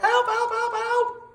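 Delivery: high, loud cry for help; a dog sinking in a well, yelping high and loud. A narrator's reading. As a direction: yelp it out, sinking. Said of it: "I yelped".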